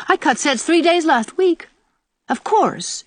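high, lively teasing. A narrator's reading. Diana boasting to the old aunt, teasing. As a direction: tease her with a bright lift in pitch. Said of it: "she added teasingly".

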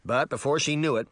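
usual speaking voice

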